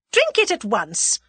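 sharp-edged, domineering order; imperious command. A narrator's reading.

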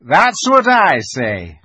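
loud, drawn-out agreement; chiming in. A narrator's reading. Mr Beaver chimes in with Susan, emphatic. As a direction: chime in heartily and slowly. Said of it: "chimed in Mister Beaver".

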